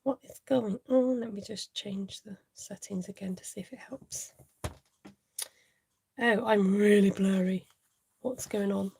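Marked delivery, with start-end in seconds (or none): 1.2-4.3 whisper. Real, not acted. Fixing settings, aside.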